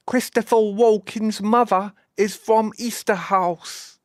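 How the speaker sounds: high, slow, over-enunciated mock English accent; put-on English accent. Shown as a demonstration. Streamer translating Scots for baffled viewers, in a put-on English accent. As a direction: translate the Scots into exaggerated, careful English pronunciation. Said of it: "In case you didn't understand what I was saying".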